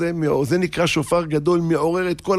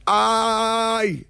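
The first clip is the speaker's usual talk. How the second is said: long, high drawn-out "ay!"; cry of pain; 6 semitones higher, much slower than usual. Retold or imitated. Preacher voices the shofar as the child's cry, long wail. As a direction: wail out one held cry. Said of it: "צעקה".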